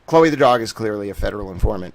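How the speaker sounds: a hint of a mock serious news anchor; Walter Cronkite newsman. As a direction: read it like a solemn evening news bulletin. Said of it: "get in my towel Walter Cronkite mode".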